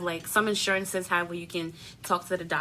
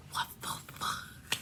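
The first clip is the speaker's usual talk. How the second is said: slow, voiceless whispered disbelief; stunned whisper; 12 dB quieter, much slower than usual. Retold or imitated. Patient told to go to the emergency room, stunned whisper. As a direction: whisper the curse slowly, as if floored.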